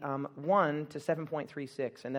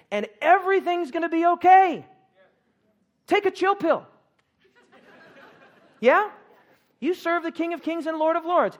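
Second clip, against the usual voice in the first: much higher and louder preacher's cry; full-force preaching; 10 semitones higher, 8 dB louder than usual. Acted. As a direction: lift the room with hope. Preaching climax of reassurance.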